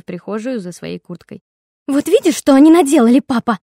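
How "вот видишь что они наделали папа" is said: loud, higher, tearful scolding; angry whining. A narrator's reading. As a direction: rage with a whine. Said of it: "ругалась в кухне Флоренс". (ярость пополам с плаксивостью)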